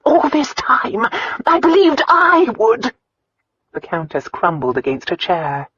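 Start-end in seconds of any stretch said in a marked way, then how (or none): 0.1-2.9 high, slow, anguished lament; devastated disbelief. A narrator's reading. Countess learns she gets nothing.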